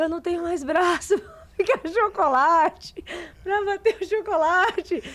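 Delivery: high, laughing, half-crying complaint; mother worn out from whisking, laughing. Retold or imitated. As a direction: whine through laughter about sore arms. Exhausted mother quoted, laughing.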